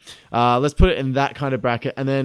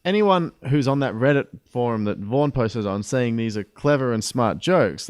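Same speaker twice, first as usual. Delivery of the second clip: normal talking voice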